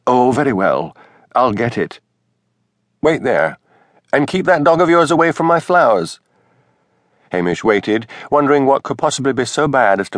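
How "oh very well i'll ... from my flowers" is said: higher minister's wife, grudgingly giving in. A narrator's reading. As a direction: yield grudgingly, then snap a higher order. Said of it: "Missus Wellington capitulated".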